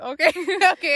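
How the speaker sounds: a high, laughing "okay, okay". Retold or imitated. Conceding to her friend while laughing, okay okay. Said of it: "And I'm like".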